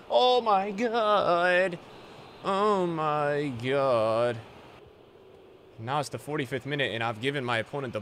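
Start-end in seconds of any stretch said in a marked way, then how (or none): 0.1-4.4 drawn-out oh my god. Real, not acted. Reacting to near miss.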